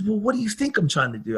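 mildly heated comeback; retold agitation. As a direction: imitate someone getting testy, fast. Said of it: "he starts to get angry".

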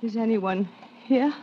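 faint, frightened calling out; barely voiced, scared and confused; weak trapped cry for help. Waking tied up in the dark.

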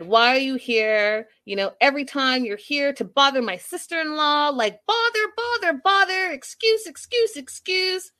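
high-pitched mock rant; worked-up retold tirade, repeated words pitched high; imitated freak-out. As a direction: imitate someone ranting at a screen, pitch climbing. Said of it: "He's screaming at the screen".

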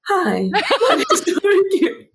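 high, laughing put-on French accent; a hammed-up French accent that breaks into laughter; different mock accent. Host tries a new French accent for Marie Curie, laughing. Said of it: "No, different accent".

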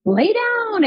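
high, drawn-out call of a mock healer; commanding healer. Healer to reporter on the massage table, mock commanding. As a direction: imitate a healer intoning an order. Healer orders her to lie down.

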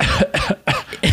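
sharp cough; a sudden cough; coughing fit. Real, not acted. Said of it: "Sorry, I, I'm coughing".

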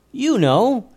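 put-on high eager boy; lightly voiced young boy. A narrator's reading. Joseph explaining to the leader, high and eager.

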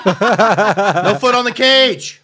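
loud, high, drawn-out ref call; UFC referee. Acted. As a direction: call it out like a cage-fight referee. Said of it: "it sounds like a U F C referee".

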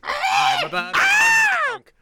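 high shouted goose cry; silly clown. Acted. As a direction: yell it like a giddy goose.

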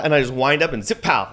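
normal talking voice

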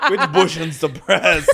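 slow, slurred words through laughter; laughing slip of tongue. Real, not acted. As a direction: stumble over the word while laughing. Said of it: "I feel drunk".

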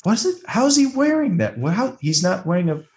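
high-pitched acted bewilderment; baffled outburst. Movie fan puzzling over the turtleneck, baffled. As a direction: pitch shoots way up in disbelief.

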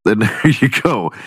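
normal talking voice

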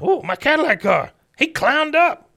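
high, loud cartoon indignation; outraged father impression. Old veteran seeing his car misused, mock outrage. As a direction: jump way up in pitch and volume, hammed-up.

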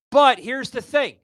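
heated rant pitched high, building anger; real fired-up tirade; getting pissed off. Real, not acted. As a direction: vent at the censors, voice climbing. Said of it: "See, I'm getting pissed off".

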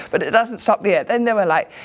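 ordinary talk, nothing marked

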